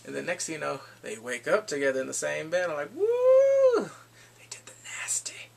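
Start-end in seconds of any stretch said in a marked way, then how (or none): 2.9-3.9 loud, high-pitched "woo"; teasing whoop. Retold or imitated. Reacts to couple waking in bed. 4.4-5.5 soft, naughty whisper; teasing whisper. Retold or imitated. Teasing aside about the couple.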